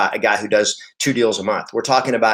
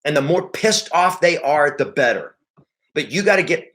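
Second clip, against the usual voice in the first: usual speaking voice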